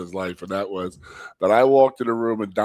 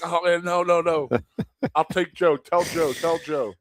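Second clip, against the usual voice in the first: lightly pitched-up begging; mock pleading; 7 semitones higher than usual. Player backing down to the coach, mild mock pleading. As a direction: give in, take the lesser evil. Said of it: "And I'm like".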